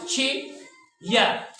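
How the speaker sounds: Chair very slowly as chee ... ya; long gap between the two sounds of chair; drawn-apart two-beat demo; syllable by syllable. Shown as a demonstration. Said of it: "एक sound हो गया".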